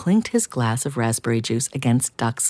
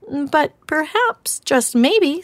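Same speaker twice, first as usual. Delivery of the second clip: much higher, small gentle critter voice; timid hedgehog offering an idea; much slower than usual. Acted.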